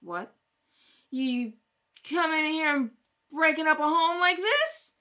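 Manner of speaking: loud, righteous telling-off; a furious mother berating police; indignant scolding. Acted. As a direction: shame them to their faces. Mother scolds arresting officers.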